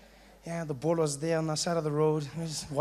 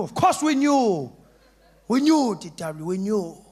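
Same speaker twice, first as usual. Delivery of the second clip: friends cheering; 6 dB louder than usual. Retold or imitated. Friends reassuring D W, loud cheerful shout.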